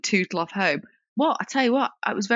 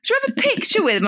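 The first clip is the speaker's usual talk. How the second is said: teasing the tall man, hammed up; imitating a brash bloke. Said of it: "he was talking like that".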